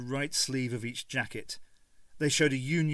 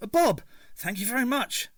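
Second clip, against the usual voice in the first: Cockney thanks; 11 semitones higher, 5 dB louder than usual. A narrator's reading. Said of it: "His Cockney accent".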